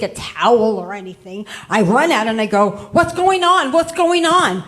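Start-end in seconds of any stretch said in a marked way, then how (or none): 3.0-4.6 much higher, louder alarmed yell; alarmed shout. Retold or imitated. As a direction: burst in demanding to know. Runs out dripping, demanding answer.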